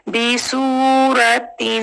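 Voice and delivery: बी, सूरतिन slow, सू held long; slow recitation; tajweed recitation. Shown as a demonstration. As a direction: hold the वाव मद्दा in सूरतिन. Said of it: "वाव मद्दा".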